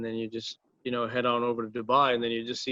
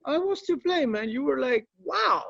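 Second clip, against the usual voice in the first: much higher, lightly put-on rock legend voice; 14 semitones higher than usual. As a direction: voice the impressed rock star casually. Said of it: "And he was like".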